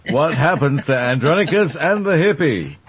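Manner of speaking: slow announcer sign-off; show sign-off. Pretend. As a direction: close the episode like a TV host. Closing like a show host.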